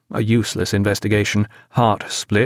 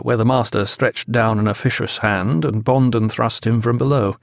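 usual speaking voice

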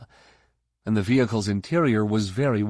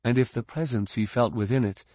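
plain reading voice

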